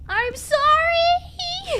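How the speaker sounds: high, loud, slow wail on sorry; hammed-up wailing sorry. Player wails at the narrator, hammed-up sob. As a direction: wail it like a melodramatic mourner.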